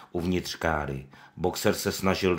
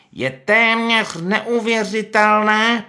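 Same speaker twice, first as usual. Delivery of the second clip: much higher, slow, louder pig voice; Pištík; 9 dB louder, much slower than usual. Acted. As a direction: put on a tearful show of grief. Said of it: "pravil Pištík".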